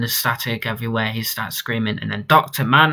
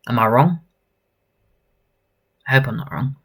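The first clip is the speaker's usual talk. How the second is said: ordinary delivery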